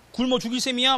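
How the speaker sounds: imitated indignant nagging; scolding wife. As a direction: berate your husband as a fed-up wife. Wife scolding him, acted out.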